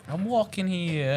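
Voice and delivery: slow, drawn New York I'm walking here; New York accent. Retold or imitated. Joking about New Yorkers, slow New York accent. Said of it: "Forget about it".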